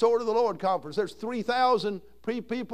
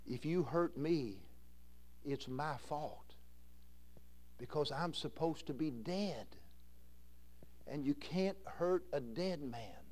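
much softer and lower, calm; gentle quiet reply; 4 semitones lower, 12 dB quieter, slower than usual. Retold or imitated. Roloff answering Bobby softly. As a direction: speak it low and gentle, a touch of an old preacher.

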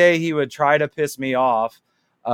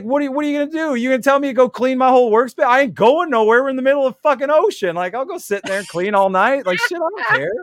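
high-pitched, rapid incredulous protest; retold cocky backtalk, pitched way up; defiant mock rant; 10 semitones higher than usual. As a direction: argue back in a much higher voice. Acted defiant comeback to shipmate.

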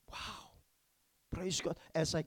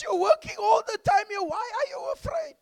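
much higher, loud incredulous shout; boss shouting; 13 dB louder than usual. Acted.

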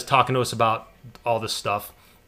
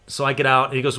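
ordinary delivery

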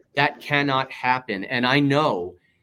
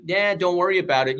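mock dismissive reassurance; 6 semitones higher, 4 dB louder than usual. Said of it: "and said to anybody".

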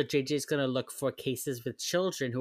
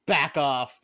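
louder back off; 6 dB louder than usual. Retold or imitated. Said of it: "walked in and was like".

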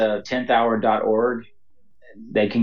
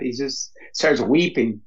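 choked up; 2 semitones higher than usual. Real, not acted. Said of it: "sorry, I was getting choked up".

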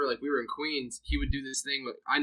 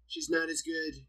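Trump; 2 semitones higher, 5 dB louder than usual. Acted. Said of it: "I feel like Trump is like a".

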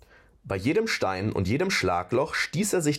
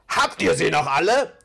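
empörter Ausruf; 7 semitones higher, 7 dB louder than usual. Acted. Torvald entsetzt über den Plan.

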